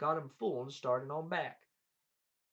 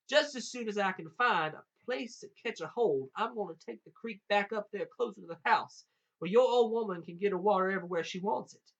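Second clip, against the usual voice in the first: put-on higher, louder storybook boy's bragging; lightly voiced cocky Jack; boastful Jack; 6 semitones higher, 5 dB louder than usual. A narrator's reading. Jack bluffing the giant about moving the creek, boastful.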